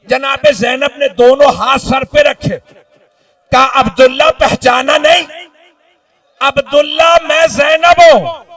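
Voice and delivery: anguished majlis climax; impassioned lament preaching. Acted. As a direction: act out the grief at mounting force. Acted dialogue in lament preaching.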